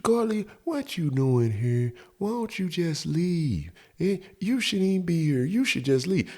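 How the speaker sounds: hushed, high mock-sweet woman; soft and innocent. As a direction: plead gently in a put-on female voice. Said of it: "talk softly or, or innocently".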